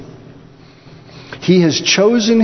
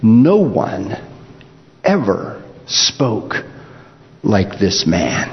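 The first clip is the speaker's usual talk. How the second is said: awed guards; 6 semitones lower, slower than usual. Acted. Awed guards answer the priests.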